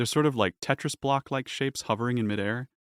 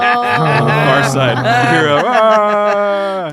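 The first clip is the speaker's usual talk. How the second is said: booming announcer; 12 semitones higher, 13 dB louder, much slower than usual. Acted. Arena announcer voice continues.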